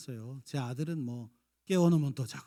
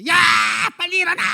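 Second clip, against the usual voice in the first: very high shrill wake-up shout; morning yell; 18 dB louder, faster than usual. Retold or imitated. Mother yelling at sleeping child in the morning, high shout. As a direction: scream the kid awake. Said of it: "일어나자마자".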